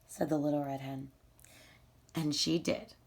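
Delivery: ordinary delivery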